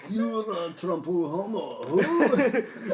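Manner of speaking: slow, loud, high mock-Japanese bellow; hammed-up Japanese caricature. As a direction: stretch it out like a cartoon Japanese samurai. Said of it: "my racist Japanese voice sounds like".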